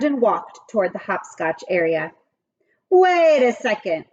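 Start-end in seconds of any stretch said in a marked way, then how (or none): 2.9-4.0 louder call after her. Acted. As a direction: call her back before she leaves. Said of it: "Louis called".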